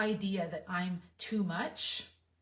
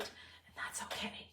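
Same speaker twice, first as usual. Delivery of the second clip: whispered reassurance; a whispered, tender it's fine; soft whisper; 13 dB quieter, slower than usual. Real, not acted. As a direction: breathe it as a gentle whisper to yourself. Reassuring herself about emotions.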